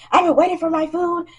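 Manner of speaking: mock tantrum of a waiting customer; whiny customer. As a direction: whine like a spoiled kid about the food. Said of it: "going on this little baby rampage".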